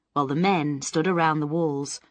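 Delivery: usual speaking voice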